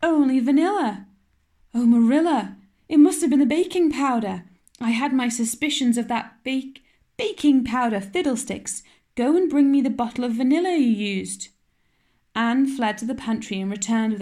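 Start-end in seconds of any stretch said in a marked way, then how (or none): 0.0-11.5 distressed. Acted. Anne and Marilla in dismay.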